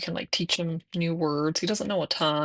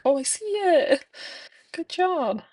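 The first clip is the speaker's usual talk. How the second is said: much higher, louder, excited praise; praising toddler; 12 semitones higher, 4 dB louder, slower than usual. Retold or imitated. Mom praising toddler for spotting the cat, high excited praise. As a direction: cheer him on like a delighted mom. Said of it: "And I'm like".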